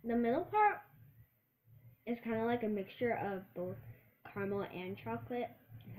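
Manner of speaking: biting and chewing between words; talking while chewing. Real, not acted. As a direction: mouth partly full of chocolate. Seen 1: Holds chocolate, bites it at 5.2 and 8.8-10.9, chewing between.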